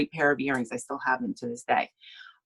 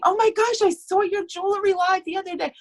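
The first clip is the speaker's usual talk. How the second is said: much higher, squealing fan voice; gushing fan. Retold or imitated. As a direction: play an excited stranger starstruck on the street. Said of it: "people stop me on the streets like I'm a celebrity, saying".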